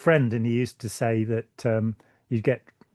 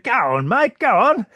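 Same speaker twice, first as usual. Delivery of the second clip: high, loud Australian egging-on; Australian accent; 10 semitones higher, 6 dB louder than usual. Retold or imitated. Australian viewers urging him to do it, loud Australian accent. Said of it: "in an Australian accent".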